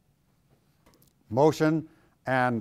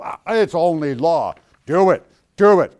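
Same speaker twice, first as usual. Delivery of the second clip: barking "do it, do it" much louder; mock stern command; 4 semitones higher, 11 dB louder than usual. Pastor mimicking legalists, loud mock commands. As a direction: hammer the rule like a harsh preacher. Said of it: "some denomination will just go".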